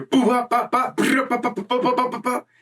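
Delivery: high, fast bop-bop punches mimicked with the mouth; imitated beating noises. Teller mimics the guards beating the frog guy behind the door. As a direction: sound out the beatdown through the door like comic-book hits. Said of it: "and I just hear".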